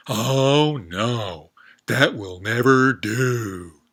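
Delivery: loud, drawn-out frog voice dismissing the idea; loud frog refusal. Acted. Frog turning down the goat, loud and slow. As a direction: stretch out never do like a snooty frog.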